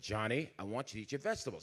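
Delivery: a mild, soft-spoken dad coaxing his son; calm dad voice. Retold or imitated. Father voiced telling son.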